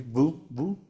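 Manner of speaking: a buzzed V, teeth on lip; dental V sound. Shown as a demonstration. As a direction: demonstrate the isolated V, low and held. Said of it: "¿Cómo se dice así?".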